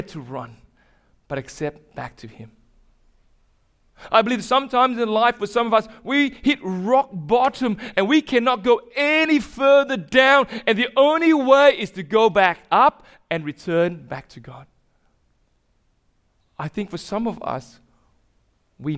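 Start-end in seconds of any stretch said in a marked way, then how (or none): 4.1-14.7 louder, higher preaching peak; full-force preaching. Acted. Preacher at the climax urging the church back to God, loud. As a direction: drive it home to the back row.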